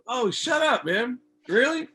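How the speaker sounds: flattered incredulous shriek; thrilled disbelief. Retold or imitated. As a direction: gush like you can't believe it. Told he is someone's biggest fan.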